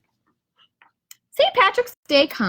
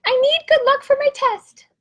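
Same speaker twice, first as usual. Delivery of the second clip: much higher, small squirrel voice; Squirrel's voice; 14 semitones higher, 5 dB louder than usual. Acted. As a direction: squeak it out like a little squirrel. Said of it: "Squirrel says".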